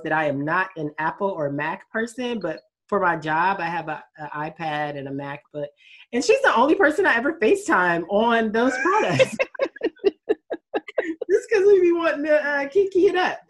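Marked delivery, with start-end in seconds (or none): 6.1-13.4 giggling through the words; laughing. Real, not acted. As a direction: laugh while you talk. Laughing about FaceTiming her friend.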